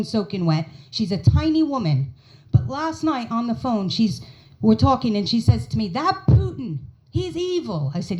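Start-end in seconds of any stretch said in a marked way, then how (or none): 5.9-7.9 mother's voice. Retold or imitated. Mother on the phone.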